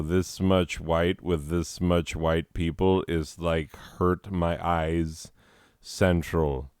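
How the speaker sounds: ordinary talk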